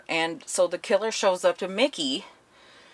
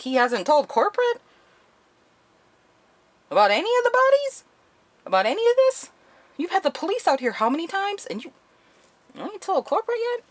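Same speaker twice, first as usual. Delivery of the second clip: incredulous outrage; 14 semitones higher, faster than usual. Retold or imitated. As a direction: squeak with disbelief at each question. Outraged disbelief at the director.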